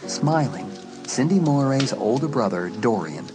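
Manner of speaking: plain reading voice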